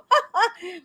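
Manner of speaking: high, brief put-on ha-ha; token fake laugh. Shown as a demonstration. Showing what doesn't count as five minutes of laughter, token laugh. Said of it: "five minutes where you go".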